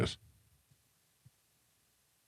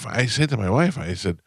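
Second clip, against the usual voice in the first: ordinary talk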